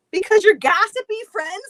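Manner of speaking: ordinary delivery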